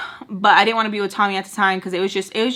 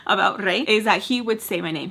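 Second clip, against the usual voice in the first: normal talking voice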